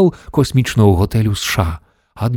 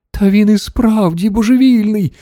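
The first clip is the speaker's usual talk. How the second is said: crying out; 11 semitones higher than usual. A narrator's reading. Grandmother declaring Mr Wonka truly mad, crying out in a high voice. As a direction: pitch it way up as an alarmed exclamation. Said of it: "крикнула бабуся Джорджина".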